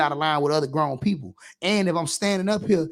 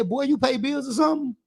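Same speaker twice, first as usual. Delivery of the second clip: put-on scolding boy; 6 semitones higher than usual. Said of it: "I say".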